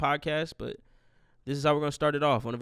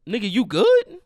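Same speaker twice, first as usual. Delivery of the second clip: loud, high taunting question; mocking payback; 7 semitones higher, 10 dB louder than usual. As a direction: throw dad's question back at him, much louder. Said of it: "walk into the casket like".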